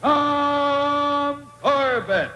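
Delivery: normal talking voice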